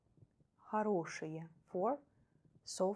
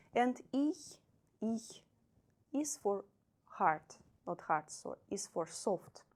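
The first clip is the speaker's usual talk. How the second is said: usual speaking voice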